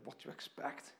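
ordinary talk

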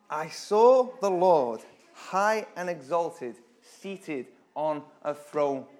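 slow, weighty recital; solemn rereading. Acted. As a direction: linger over each word. Rereads verse slowly.

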